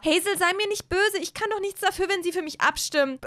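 high-pitched appeasing plea; pleading protest. Acted. Olivia begs Hazel not to be cross, pleading.

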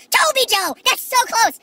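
high, loud delighted cry; retold excited near-miss reply; excited correction. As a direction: shout it out delighted. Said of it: "I'm like".

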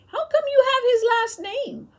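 genuinely taken aback; puzzled surprise. A narrator's reading. Boy learning his friend carries the master's name, surprised.

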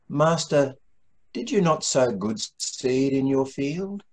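normal talking voice

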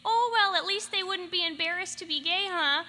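put-on high, bright Minnesota mom accent; Minnesotan accent. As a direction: do your mom's Minnesota accent. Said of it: "in the Minnesotan accent".

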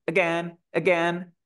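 "Again" with the first vowel passed over in an instant; very quick schwa. Shown as a demonstration. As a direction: rush through the reduced A of "Again". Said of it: "Es súper rápido". ("Again" con una schwa súper rápida)

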